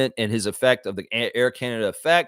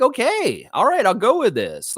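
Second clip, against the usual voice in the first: high, louder going-along voice; acted-out okay, raised well above normal; acted acceptance; 10 semitones higher, 4 dB louder than usual. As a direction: pitch it way up as you give in. Acts out his own surprised acceptance.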